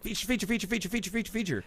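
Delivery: high rapid chant of feature; imitating a rushed developer; frantic repeating. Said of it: "where it's just like".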